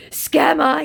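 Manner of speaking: high, mocking put-on voice for the scammer; whiny high imitation of a chat scammer.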